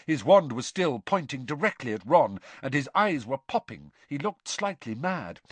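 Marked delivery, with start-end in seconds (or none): none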